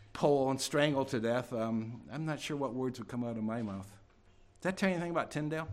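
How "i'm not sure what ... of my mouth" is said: ordinary talk, nothing marked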